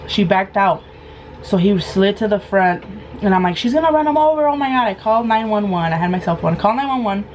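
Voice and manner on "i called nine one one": usual speaking voice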